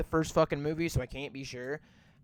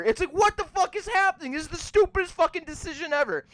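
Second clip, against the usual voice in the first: much higher and louder, fast and disbelieving; incredulous outburst; 11 semitones higher, 4 dB louder, faster than usual. Real, not acted. Ranting to viewers about the flashback, exasperated high outburst. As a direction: throw your hands up at how dumb it is.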